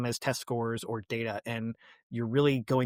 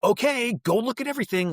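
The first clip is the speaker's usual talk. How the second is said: breezy teacher send-off; 9 semitones higher, 3 dB louder than usual. Retold or imitated. Voicing teacher turning kids loose.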